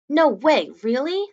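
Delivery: high, loud thrilled voice; can't believe the good news; excited boy. A narrator's reading. Boy thrilled about school costumes, high excited voice.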